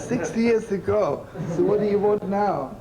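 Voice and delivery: high, loud, laughing through it; laughing retort. Retold or imitated. Narrator retorting to the old woman, laughing high and loud. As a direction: repeat it in disbelief, laughing.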